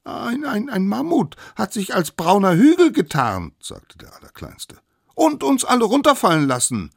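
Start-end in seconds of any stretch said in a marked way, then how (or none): 0.0-3.5 high, stammering start into an indignant report; squeaky high kid-character voice; excited little one. Acted. Smallest one excitedly excuses himself. 5.2-6.9 high, loud, fast complaint; high-pitched character voice, outraged; indignant little one. Acted.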